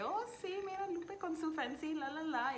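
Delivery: high, teasing imitation of a fancy Spanish accent; imitating a friend mocking her fancy accent. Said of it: "Ella siempre me dice que".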